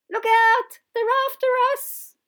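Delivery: very loud, very high panicked warning; alarmed shout. Acted. Professor warning the children as bugs attack, shouting.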